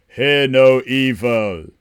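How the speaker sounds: slow, loud dramatic title read. Shown as a demonstration. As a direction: announce it like a movie trailer. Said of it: "I'll do some acting for yous".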